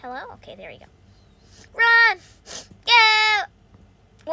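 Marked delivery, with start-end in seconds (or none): 1.7-4.3 loud, high command; urgent shout. Real, not acted. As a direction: yell to get moving. Urging on during chase.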